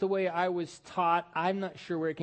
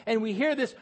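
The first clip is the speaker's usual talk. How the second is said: ordinary delivery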